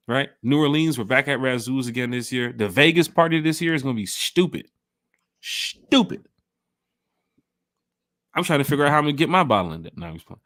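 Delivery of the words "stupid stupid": STUPID stretched on a long opening sh; hyped emphasis. Real, not acted. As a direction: draw out the start of STUPID, then pitch it up. Emphatic hype about Vegas party.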